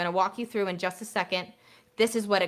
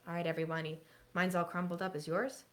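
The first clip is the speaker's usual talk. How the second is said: soft teacher prompt; 9 dB quieter than usual. Shown as a demonstration. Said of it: "when I say".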